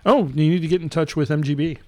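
ordinary talk, nothing marked

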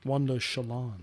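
"Shalon" with weight on LON, low and soft; corrected syllable stress. Real, not acted. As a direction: quietly rehearse "Shalon" as sha-LON. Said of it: "make a note of that".

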